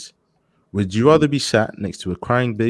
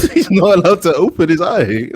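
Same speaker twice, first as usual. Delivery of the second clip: laughing through the words, louder; 8 semitones higher, 7 dB louder than usual. Real, not acted. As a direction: giggle while saying it. Misheard friend, laughing disbelief.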